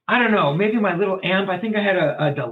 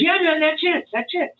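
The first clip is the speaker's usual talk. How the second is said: imitating Ed's approval; 12 semitones higher than usual. Voicing the teacher's approval.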